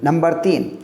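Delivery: ordinary delivery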